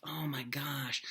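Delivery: hushed, breathless oh my gosh; a soft reverent whisper; awed whisper. Acted. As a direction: whisper it like you can't believe it. Awed aside about the love scene.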